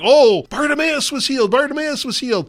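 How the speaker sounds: high-pitched, mock-amazed townsperson; excited news-spreading. Townsfolk spreading word of a healing, excited.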